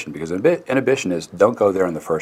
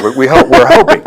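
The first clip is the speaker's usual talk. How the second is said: much higher and louder, a rueful laughing hope; wry hopeful joke; 13 dB louder than usual. Real, not acted. Guest joking with the host about adults: amused, hopeful. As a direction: toss off the hope as a joke, pitched way up.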